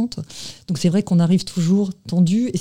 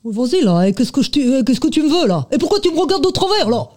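aggressive street accent, climbing higher and higher; put-on street accent; 8 semitones higher than usual. Shown as a demonstration. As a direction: pick a fight in a rough street accent. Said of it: "qu'est-ce que vous pourriez nous faire comme accent". (accent de rue agressif, de plus en plus aigu)

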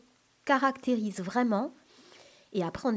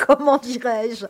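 laughing; 4 semitones higher, 10 dB louder than usual. Real, not acted. Laughing while hunting for a word.

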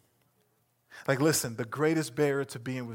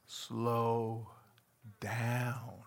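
soft slow gentle command; calm him down softly; 5 semitones lower, 6 dB quieter than usual. Acted. Jesus's words, gentle.

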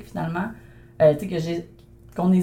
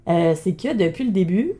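normal talking voice

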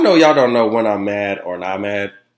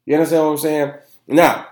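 ordinary talk

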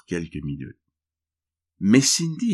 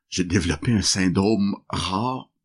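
usual speaking voice

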